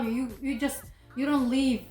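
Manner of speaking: ordinary delivery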